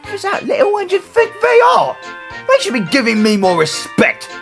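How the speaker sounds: very high, indignant ranting; outraged. Acted. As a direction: demand respect in an affronted, high-pitched outburst. Silas outraged at the rebuke.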